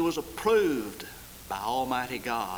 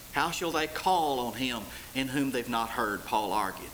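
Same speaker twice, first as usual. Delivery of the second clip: ordinary talk, nothing marked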